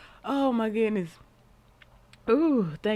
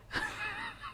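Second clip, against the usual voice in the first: high laugh; 9 dB quieter than usual. Real, not acted. Host laughing at puppet.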